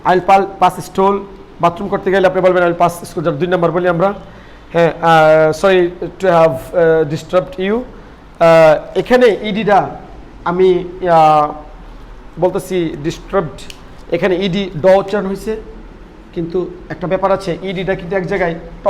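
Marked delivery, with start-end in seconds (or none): none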